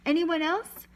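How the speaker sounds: loud, high rising call; yelled question. A narrator's reading. Person on the boat yelling to the sailor. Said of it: "The person on the boat yelled".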